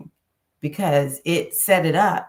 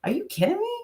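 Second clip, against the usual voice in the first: much higher, disbelieving squeal; mock outrage, pitched way up; incredulous; faster than usual. Scolding herself for a mean thought, incredulous. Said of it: "I'll talk like that to myself".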